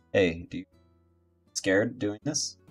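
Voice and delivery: usual speaking voice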